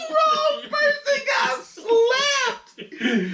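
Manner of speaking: high-pitched, helpless laughing shriek; cracking up. Real, not acted. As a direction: squeal the words through real laughter. Cracking up at the story.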